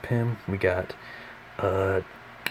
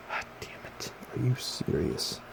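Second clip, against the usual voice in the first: whispered exasperation; frustrated whisper; 3 semitones higher, 10 dB quieter than usual. Real, not acted. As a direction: hiss it under your breath. Figure falls over again.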